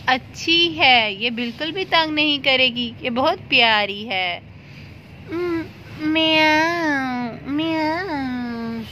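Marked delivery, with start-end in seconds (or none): 5.3-8.8 slow meow. Acted. Cat meows sweetly.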